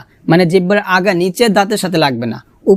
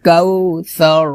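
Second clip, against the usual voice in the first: slow chant, long vowels held; recitation to repeat; much slower than usual. Shown as a demonstration. Teacher leads students repeating the verse, slow chant. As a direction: chant it slowly so the class can follow. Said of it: "আমার সাথে বলেন".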